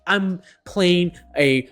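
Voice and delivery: slow, loud put-on baddie voice; goth baddie voice. Goth baddie voiced for a friend, slow and loud. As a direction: drawl it out like a goth villain.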